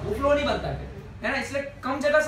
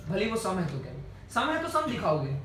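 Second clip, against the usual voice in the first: usual speaking voice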